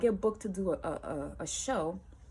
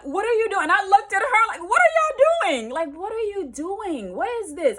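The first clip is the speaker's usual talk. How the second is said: incredulous demand; 11 dB louder than usual. Retold or imitated. She confronts both of them, loud and incredulous.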